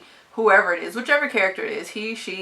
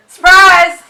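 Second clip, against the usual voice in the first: high shouted "Surprise!" after a laugh; mock prank reveal; 11 semitones higher, 17 dB louder than usual. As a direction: yell it like springing a prank.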